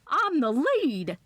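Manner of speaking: high, slow, thrilled announcement; joyful cry. A narrator's reading. Aunt crying out joyfully to the visitor she's the lead. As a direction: let it burst out with joy, drawn out. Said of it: "she cried out".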